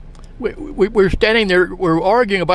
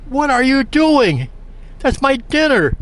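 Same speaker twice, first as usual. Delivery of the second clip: high, drawn-out retold outrage; indignant protest; 9 semitones higher than usual. Man whose dinner was thrown down, indignant protest. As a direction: act out an aggrieved, higher-pitched objection.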